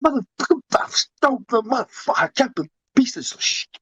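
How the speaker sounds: high, strained mock cursing fit; ranting to oneself. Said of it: "You should hear me when I'm washing my dishes, talking to myself".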